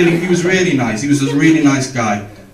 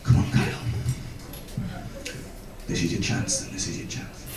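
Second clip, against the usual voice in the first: whispered self pep talk; 12 dB quieter than usual. Retold or imitated. A boy at bat psyching himself up, whispered. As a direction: whisper yourself courage.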